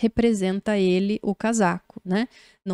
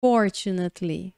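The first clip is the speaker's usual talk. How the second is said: "fortunately" with FOR punched, rest lighter; first-syllable stress; 3 semitones lower than usual. Shown as a demonstration. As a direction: stress "fortunately" as FOR-chu-nat-ly. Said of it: "é a sílaba tônica". ("fortunately" com força na primeira sílaba)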